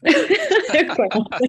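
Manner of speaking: laughing through the words, up high; amused confession with a real laugh. Real, not acted. Laughing at her own joke.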